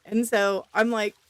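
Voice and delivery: ordinary talk, nothing marked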